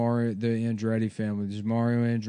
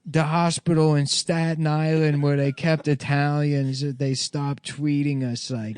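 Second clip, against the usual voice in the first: put-on Italian accent; 3 semitones higher than usual. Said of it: "He's doing an Italian accent".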